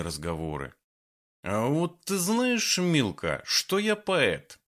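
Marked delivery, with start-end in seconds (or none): 1.4-4.6 put-on tipsy old man voice. Drunk poet talks to goat.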